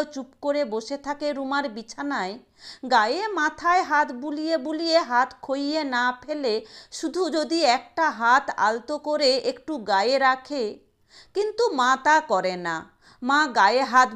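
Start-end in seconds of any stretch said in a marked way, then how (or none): none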